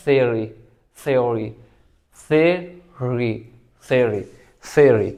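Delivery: "Theory" as THEE-ree, no y-glide, falling; correct pronunciation. Shown as a demonstration. Teacher drills students, correct careful "Theory". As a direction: repeat "Theory" slowly and separately as "theery". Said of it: "लेकिन ये क्या है?".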